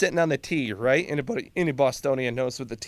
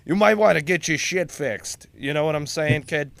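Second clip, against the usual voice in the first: Bostonian accent; 2 semitones higher, 4 dB louder than usual. Shown as a demonstration. Colonel teasing the volunteer, in a Bostonian accent. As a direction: play up the Boston street talk. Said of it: "what that sounds like in a Bostonian accent".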